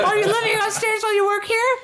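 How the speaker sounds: put-on very high girl, laughing through it; childlike squeak with a giggle; little girl voice. Voicing the girl character.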